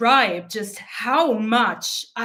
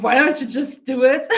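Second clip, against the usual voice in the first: imitating mom with a laugh in the voice; lightly voiced mom, laughing it out; mom's question, laughing; 4 dB louder than usual. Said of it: "dropped that bomb of".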